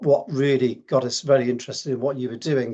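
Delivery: ordinary delivery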